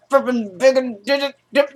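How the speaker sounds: imitated wordless angry fluster; mad sputtering. Doctor ordered out of the room, mock-mad sputtering. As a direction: stammer nonsense syllables like someone fuming. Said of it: "That's how he, he was looking mad".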